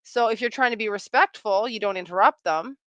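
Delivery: usual speaking voice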